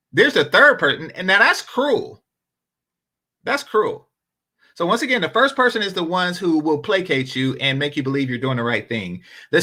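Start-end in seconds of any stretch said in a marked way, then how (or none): none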